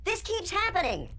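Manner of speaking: high-pitched cry of frustration; frustrated, high and rising to a yell; exasperated outburst. Acted. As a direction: wail it out, fed up. Game night cancelled, angry outburst.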